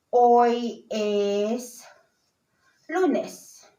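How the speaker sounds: slow, spaced-out teaching delivery; word by word. Shown as a demonstration. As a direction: separate each word for the learner. Teacher models the answer slowly.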